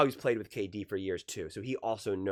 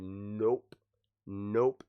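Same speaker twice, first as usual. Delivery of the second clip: low, cool "nope", voicing an unshaken team; composed refusal; 4 semitones lower than usual. Team USA answering confident Serbia, composed refusal. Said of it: "And Team U S A said".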